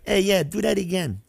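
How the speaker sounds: high, smiling mock threat from [name]; playful but menacing, said with a smirk. [name] teasing the card player, smirking mock threat. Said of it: "in the most funniest way, threatening".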